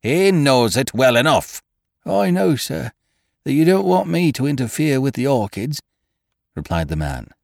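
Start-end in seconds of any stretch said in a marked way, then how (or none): 0.0-1.6 fierce, louder and higher; suddenly fierce. A narrator's reading. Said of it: "His voice grew suddenly fierce". 2.0-5.8 high, deferential servant voice; a nervous old gardener pleading; humble gardener voice. A narrator's reading.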